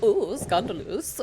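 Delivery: lightly hammed-up German accent, slow; a mock-scandalised German drawl; flamboyant German accent. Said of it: "my really flamboyant German accent".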